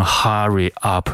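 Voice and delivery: "hurry up" with open ah, "hah-ree ahp"; mistaken pronunciation. Shown as a demonstration. Teacher warns students, "hurry up" with the wrong ah vowel. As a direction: say "hurry up" badly as "harry ahp". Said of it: "不要读成".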